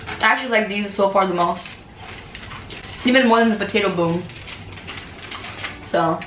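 speaking between bites; talking while chewing. Real, not acted. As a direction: mouth full of snack. Seen 1: She bites and chews the stick while saying these words.

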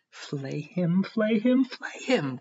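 insistent cry peaking high and loud in the middle; clamor for it, surging then dropping; frantic clamor. A narrator's reading. Elena clutching at the German's coat, clamoring. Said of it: "clamored Elena Ivanovna".

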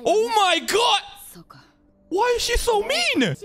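very high-pitched, scandalised exclamation; shocked outcry. Real, not acted. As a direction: cry out high in indignant disbelief. Shocked reaction to character's rudeness.

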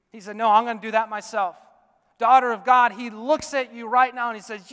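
loud, high, ringing pulpit voice; full-force preaching, high and loud. Acted. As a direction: preach it out over the whole room.

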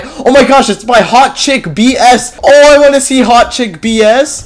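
high-pitched, hyped gamer delight; excited exclamation. Real, not acted. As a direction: blurt it out, thrilled. Delighted by the game's maker.

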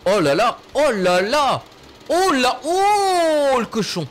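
high, slow cries of wonder; excited wonder. Real, not acted. Watching the vehicle roll, high cries of wonder. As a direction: gasp at it, voice rising high.

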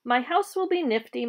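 plain reading voice

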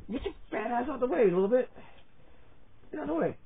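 high-pitched teasing shoo. Real, not acted. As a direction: push the dog aside in a squeaky voice. Shooing dog from camera.

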